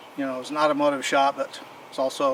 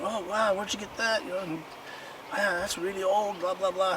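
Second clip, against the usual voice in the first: imitating an amazed bike shop owner, pitched higher; mock gasp of wonder at an old find; 6 semitones higher, 4 dB quieter than usual. Bike shop owner marvels at the old wooden wheel, amazed and higher.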